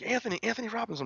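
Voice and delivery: high, fast calling of his name; excited fan shouting his name. Retold or imitated. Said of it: "screaming my name".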